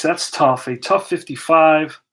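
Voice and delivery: normal talking voice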